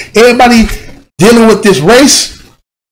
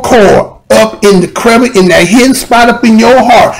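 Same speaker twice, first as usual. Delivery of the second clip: ordinary talk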